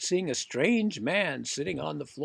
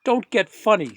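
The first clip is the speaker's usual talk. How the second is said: louder, sharply offended warning; louder, indignant Popper; 4 semitones higher, 9 dB louder than usual. Acted. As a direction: put the cheeky workman in his place. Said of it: "said Mister Popper indignantly".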